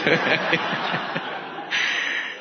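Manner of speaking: long, soft chuckle; trailing, mostly breathy laughter; amused laugh. Real, not acted. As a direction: laugh quietly at your own story. Laughs after retelling the brush-off.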